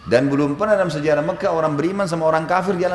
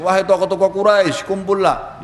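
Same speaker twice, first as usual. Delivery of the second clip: raised, louder summons; Abu Bakar; 5 semitones higher, 3 dB louder than usual. Retold or imitated. Abu Bakar summons the Quraysh leaders, calling out. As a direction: call the crowd together. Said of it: "panggil orang-orang Quraisy".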